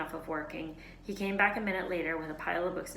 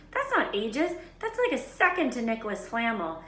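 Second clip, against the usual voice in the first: higher, louder know-it-all voice; bossy schoolgirl; 5 semitones higher, 5 dB louder than usual. A narrator's reading. Hermione scolding Ron about revision time. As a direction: lecture him like a swot.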